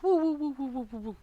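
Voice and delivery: string of high woos fading away; tumbling whoops. A narrator's reading. Brer Rabbit tumbles through the air, fading high whoops. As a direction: whoop smaller and smaller as you fly off. Tumbling head over heels.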